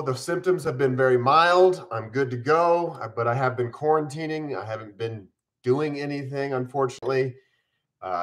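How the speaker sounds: ordinary delivery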